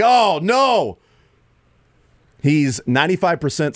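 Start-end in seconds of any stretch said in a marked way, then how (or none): 0.0-1.0 high, louder cry of alarm; mock dismay. Host imitating teammates running from the injury, loud mock dismay.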